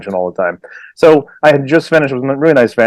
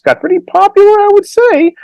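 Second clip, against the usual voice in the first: high squeaky boast; 4 dB louder than usual. Real, not acted. Boastful aside.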